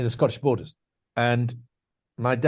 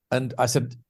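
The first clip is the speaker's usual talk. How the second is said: normal talking voice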